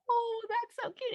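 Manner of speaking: slow, high, smiling coo. Shown as a demonstration. Parent cooing at a sad child, smiling and high. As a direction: coo it with a smile. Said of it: "you got a smile on your face".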